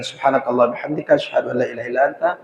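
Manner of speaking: quiet fast closing prayer. Acted. Teacher closing the class with a quick, quiet prayer.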